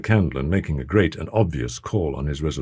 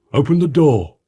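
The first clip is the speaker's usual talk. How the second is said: urgent call; 9 semitones higher, 5 dB louder than usual. A narrator's reading. Butler orders door opened.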